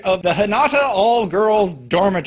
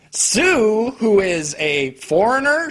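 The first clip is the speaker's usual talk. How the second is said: ordinary delivery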